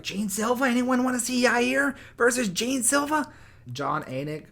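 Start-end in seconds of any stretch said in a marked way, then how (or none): 0.0-3.3 high, loud, mocking Rogan voice; mock Joe Rogan. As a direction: parody him squeaky and smug. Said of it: "Joe Rogan's like".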